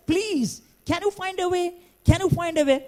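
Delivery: high pleading voice of the condemned man; pleading prisoner. Retold or imitated. Prisoner begging the jail superintendent, high and pleading. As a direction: beg for your life.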